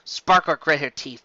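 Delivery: plain reading voice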